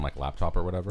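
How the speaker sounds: normal talking voice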